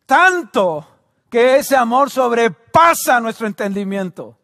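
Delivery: impassioned preaching after a climax; fervent proclamation. Real, not acted. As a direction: declare it forcefully to the whole church.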